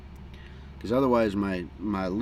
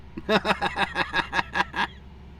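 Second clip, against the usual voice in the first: amused chuckle; a quick real laugh; short laugh; 9 semitones higher than usual. Real, not acted.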